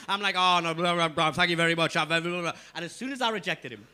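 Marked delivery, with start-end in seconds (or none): 0.4-2.5 talking through a mouthful of chicken; mumbling round a bite of food; mouth full. Retold or imitated. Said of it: "But my mouth's full of chicken".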